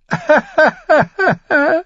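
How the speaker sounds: high, loud cackling laugh; knight's derisive high laughter; mocking laugh. A narrator's reading. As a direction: laugh scornfully at the top of your range. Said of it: "Der Ritter mit dem Stachelhelm lachte".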